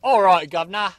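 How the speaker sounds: hammed-up London Cockney; mock Cockney accent. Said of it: "that's a bit of Cockney wibbly wobbly".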